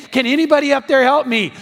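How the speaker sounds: high, loud shout upward; acted scream for help. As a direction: holler for rescue. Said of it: "He screams again".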